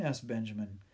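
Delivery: ordinary delivery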